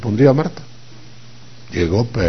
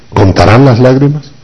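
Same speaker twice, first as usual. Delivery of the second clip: ordinary delivery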